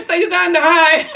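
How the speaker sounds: light up at seeing viewers; excited greeting. Real, not acted. Greeting live viewers, high and loud with a laugh.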